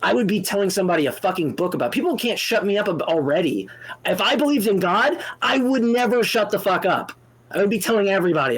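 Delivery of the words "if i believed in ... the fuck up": higher, raised and heated; heated outburst. Real, not acted. Telling the caller how he'd preach God, heated. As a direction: let it burst out, near shouting.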